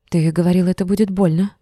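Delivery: ordinary delivery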